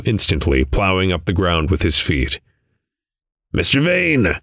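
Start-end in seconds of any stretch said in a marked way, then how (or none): 3.5-4.4 high, lightly voiced raven; croaking raven. A narrator's reading. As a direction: call out as a raven, pitched up. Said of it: "croaked the raven".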